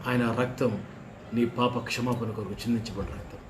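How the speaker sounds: normal talking voice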